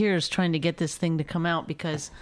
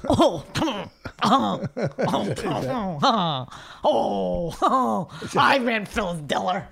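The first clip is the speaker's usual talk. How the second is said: hammed-up bumbling imitation with repeated groaned ohs; mocking Rich; much slower than usual. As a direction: badly mimic your partner's slurred bragging. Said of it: "shitty impression of you".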